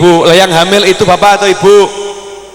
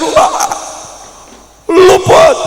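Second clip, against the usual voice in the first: playful missed-it cry; 10 semitones higher, much slower than usual. Acted. Grandfather missing the running grandson, high playful cry. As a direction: tease the child who got away.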